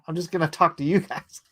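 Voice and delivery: ordinary talk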